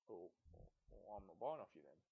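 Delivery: a hint of a low, grumbling buyer refusing; mild low put-on voice of a buyer; lightly voiced buyer. Turning down a seller without a manual, low and lightly voiced. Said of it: "someone asks".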